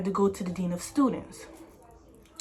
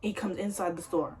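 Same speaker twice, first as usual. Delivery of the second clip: normal talking voice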